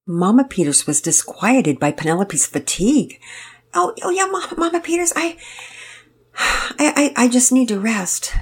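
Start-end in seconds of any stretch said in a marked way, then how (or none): 3.7-8.3 put-on high, halting and winded young girl; out of breath. A narrator's reading. Penelope answers Mama Peters, breathless and stammering. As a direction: stammer it out, too tired to get words out. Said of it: "you sound out of breath".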